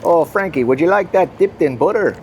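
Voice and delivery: put-on an old Dutch lady at the market offers Frank butter, in a Pennsylvania Dutch accent. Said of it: "I'll try and do the accent good".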